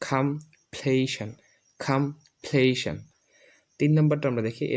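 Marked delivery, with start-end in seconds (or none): 0.1-3.0 কমপ্লিশন slow, "kham-PLAY-shan", falling; new pronunciation. Shown as a demonstration. Teacher models for learners, কমপ্লিশন as slow "kham-playshan". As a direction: say কমপ্লিশন slowly with aspirated k and "shan" ending. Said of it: "আমরা উচ্চারণ করব".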